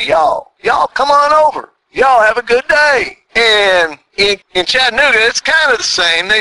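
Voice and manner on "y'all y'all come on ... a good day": drawn-out, higher Texas "y'all"; slow, lifted Texas hospitality accent. Shown as a demonstration. Showing how Texans invite several people over, slow Texas accent. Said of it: "down south, when I was in Texas".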